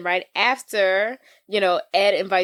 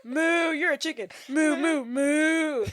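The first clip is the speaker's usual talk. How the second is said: high, playful moo sounds; mock mooing; 6 semitones higher, slower than usual. Teasing a friend as a chicken, high mock moo. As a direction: taunt him with a silly cow moo. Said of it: "That's not what chickens sound like".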